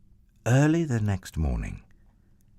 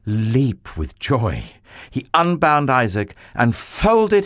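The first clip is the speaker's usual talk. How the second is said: louder, higher and slow with feeling; swelling emotion; 6 semitones higher, 6 dB louder, slower than usual. A narrator's reading. Narrator tells of Abraham embracing his son, loud and moved. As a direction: let the joy overflow. Climactic joy and sobbing reunion.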